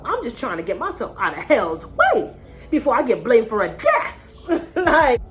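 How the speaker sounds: high-pitched laugh while talking; laughing through words. Real, not acted. Laughing at absurd situation.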